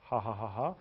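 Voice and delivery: low, soft fake laugh; mock chuckle. Coach caps offer to teach her, low mock chuckle. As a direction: put on a low ha-ha.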